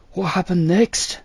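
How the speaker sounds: high, eager question; curious. A narrator's reading. Jethro asks the old storyteller eagerly, curious. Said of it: "Jethro asked curiously".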